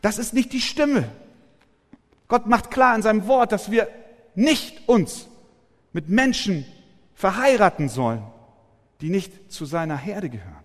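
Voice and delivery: loud, high, emphatic pulpit voice; full-force preaching. Acted. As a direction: hammer the point home to the flock.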